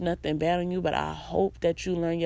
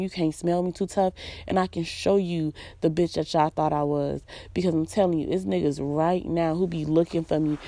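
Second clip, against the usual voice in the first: ordinary talk, nothing marked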